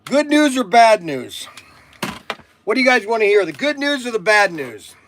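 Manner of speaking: loud, high call to the viewers; yelling. Real, not acted. Streamer asking viewers to pick good or bad news, yelling. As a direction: holler it out, drawing out the words. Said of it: "why am I yelling".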